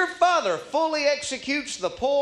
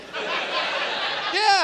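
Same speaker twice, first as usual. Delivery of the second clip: scoffing laugh; 10 semitones higher than usual. Retold or imitated. Laughing at the check offer.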